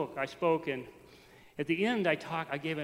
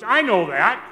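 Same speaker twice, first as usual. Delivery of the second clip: very loud, higher retort; emphatic mimicked uncle; imitated insistence; 7 semitones higher, 18 dB louder, slower than usual. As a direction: brush the objection aside at full volume. Uncle impatiently insists, retold.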